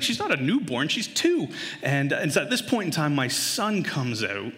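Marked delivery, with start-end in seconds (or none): 0.0-1.5 high-pitched comic disbelief; exasperated protest. Acted. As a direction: protest as if it's absurd. Exasperated dad arguing with wife.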